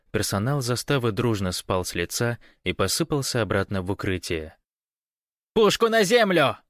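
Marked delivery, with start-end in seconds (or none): 5.5-6.6 very high, loud command; alarmed guard yelling over a loudspeaker; yelled order. A narrator's reading. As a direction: scream at him to disarm. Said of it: "заорал динамик".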